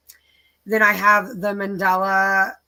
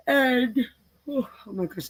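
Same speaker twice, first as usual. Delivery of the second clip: yawning; 5 semitones higher than usual. Real, not acted. Going over her kit list, yawning mid-sentence. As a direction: yawn right through it. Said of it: "I'm sorry I'm yawning".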